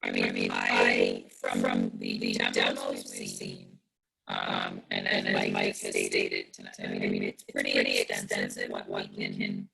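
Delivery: distorted, buzzing audio through a fan. Real, not acted. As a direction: voice garbled by fan noise. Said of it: "it sounds like you're speaking into a fan".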